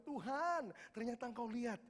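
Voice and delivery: high-pitched, swelling cry to God; retold outpouring, pitched high and building; overflowing emotion. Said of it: "meluap semuanya".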